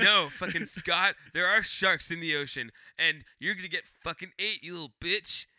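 loud, scolding mock mother; imitated mom telling off her kid; fed-up mom. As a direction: lay down the law as an exasperated mom. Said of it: "She was like".

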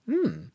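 high pleased hum; a contented hum; pleasure hum. Shown as a demonstration. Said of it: "So pleasure is like".

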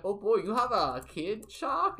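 put-on high, rising German-accented question. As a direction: ask in surprise with a German accent. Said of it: "And they're like".

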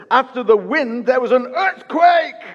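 "earthquake" cried out high and loud; rising, booming scripture shout; dramatic shout. Acted.

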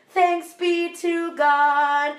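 slow, high chanted response; congregation response. Retold or imitated. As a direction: intone the response solemnly. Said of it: "we all have to go".

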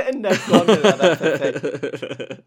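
laughing loudly through the words. Real, not acted. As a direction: burst out laughing while saying it. Laughing at Gondel drink joke.

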